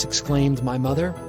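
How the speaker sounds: usual speaking voice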